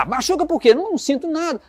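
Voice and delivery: tom agudo de pouco caso; desdém encenado. Acted. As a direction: imitar alguém que desdenha, com a voz lá em cima. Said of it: "até um tom de voz". (desdenhoso, em tom agudo)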